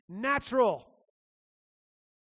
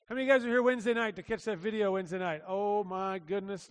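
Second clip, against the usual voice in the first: usual speaking voice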